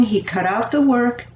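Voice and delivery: plain reading voice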